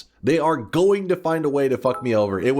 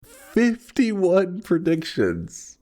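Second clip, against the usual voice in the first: slow, mock solemn announcement; 6 semitones higher, much slower than usual. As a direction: read it like a eulogy, slowly. Announcing lost streak.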